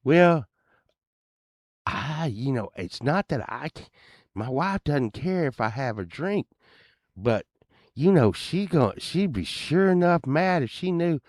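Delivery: high, hedging henpecked husband; old neighbor's voice. Retold or imitated. Neighbor fretting to the band about his wife, high hedging voice. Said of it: "he talked like this".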